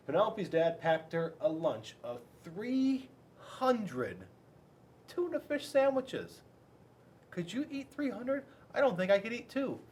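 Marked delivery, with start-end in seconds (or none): 2.4-6.4 high, incredulous stress on the number; amazed. A narrator's reading. Amazed aside about 300 sandwiches. 7.3-9.8 incredulous. Real, not acted. Asks kids in disbelief.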